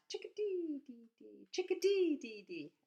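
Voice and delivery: high chickadee then dropping dee dee; chickadee call. Retold or imitated.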